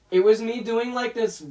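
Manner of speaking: ordinary delivery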